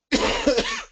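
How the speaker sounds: sudden real cough; a short dry cough. Real, not acted. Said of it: "I'm coughing a little bit again".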